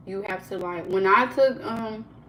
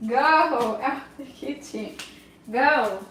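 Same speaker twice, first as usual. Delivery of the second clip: slow, drawn-out raised order; commanding the dog; 3 semitones higher, 3 dB louder, much slower than usual. Real, not acted. Owner shooing a licking dog from the kitchen, commanding. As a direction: send the dog off with a long, firm call.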